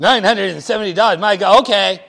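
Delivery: fast, loud, thrilled repeat of the bid; delighted hostess. Acted. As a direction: gush over the offer. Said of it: "My name is Mabel".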